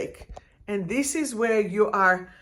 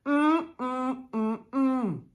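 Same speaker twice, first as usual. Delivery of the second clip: clipped nasal mm sounds of annoyance; mock sulky humming; 2 semitones higher, 3 dB quieter, much slower than usual. Coach mocking a triggered student, sulky hums. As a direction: hum like a sulking child. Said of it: "things that makes you go".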